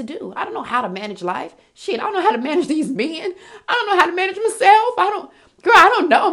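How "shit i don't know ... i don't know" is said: high, loud and fast, building into a helpless laugh; laughing exasperation. Real, not acted. Confessing to listeners she can't manage life, laughing exasperation. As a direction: throw up your hands, laughing at yourself.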